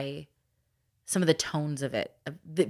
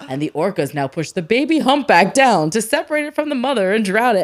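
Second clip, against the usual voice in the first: mock nature documentary narration, louder; documentary narrator; 3 semitones higher, 8 dB louder than usual. Mom imitating the Blue Planet narrator, mock documentary voice. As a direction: intone it like a wildlife film voiceover.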